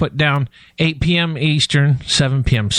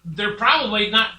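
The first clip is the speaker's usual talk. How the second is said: laughing; 6 semitones higher, 6 dB quieter than usual. Real, not acted. Joking about quiet kids.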